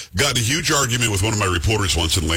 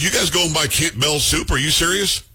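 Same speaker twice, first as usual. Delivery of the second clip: higher-pitched mock disbelief, drawn out; incredulous arguing; 5 semitones higher than usual. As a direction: challenge them as if it's absurd. Retelling his argument with the reporter.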